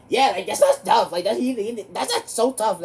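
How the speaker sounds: high, loud sneering imitation; a loud, high mock of Lewis sneering; mocking classmate. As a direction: mimic him taunting you.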